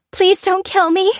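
high, loud frightened plea; begging. A narrator's reading. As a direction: beg for your life at gunpoint. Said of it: "the young woman begged".

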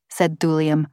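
ordinary delivery

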